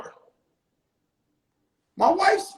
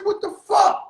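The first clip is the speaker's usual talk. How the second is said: shocked outburst; 9 semitones higher, 4 dB louder than usual. Retold or imitated. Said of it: "I said".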